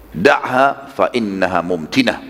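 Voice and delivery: ordinary talk, nothing marked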